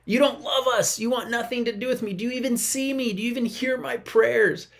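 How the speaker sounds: a lightly hammed-up hurt cry to God; whiny complaint to God. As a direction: act out a sulking believer. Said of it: "we go".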